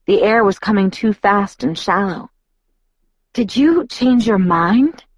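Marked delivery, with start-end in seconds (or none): 3.3-5.0 slow, high-pitched question with a touch of breathlessness; understated breathless wonder, rising at the end. A narrator's reading. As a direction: ask it mildly breathless, drawn out. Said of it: "I asked breathlessly".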